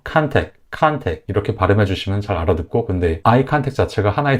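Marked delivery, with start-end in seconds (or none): 0.0-1.2 컨택 with a strong first syllable, KAHN-tek; stressed syllable brought out. Shown as a demonstration. Teacher modelling contact for learners, stressed 컨택. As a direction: punch the first syllable of 컨택, no 트 at the end. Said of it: "강세 음절을 살려서".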